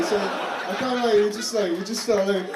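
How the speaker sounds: ordinary talk, nothing marked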